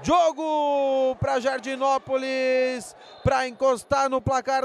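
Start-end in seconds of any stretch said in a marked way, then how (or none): 0.0-2.9 stretched-out goal shout; long drawn-out goal scream; goal call. Real, not acted.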